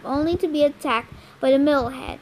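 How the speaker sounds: ordinary delivery, nothing marked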